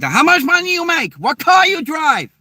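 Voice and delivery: very high-pitched mock Hong Kong girl; Hong Kong accent. Girls from Hong Kong grilling a guy, squeaky mock accent. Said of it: "girls from Hong Kong are like".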